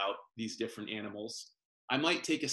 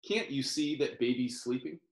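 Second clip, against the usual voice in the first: ordinary delivery, nothing marked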